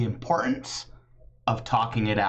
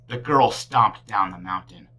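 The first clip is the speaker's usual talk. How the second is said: ordinary delivery, nothing marked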